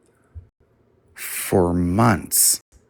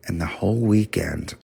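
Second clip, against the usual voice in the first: ordinary talk, nothing marked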